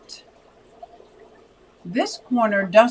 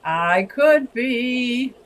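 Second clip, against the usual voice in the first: drawn out; 3 semitones higher, much slower than usual. Real, not acted. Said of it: "am I reading the same chat twice".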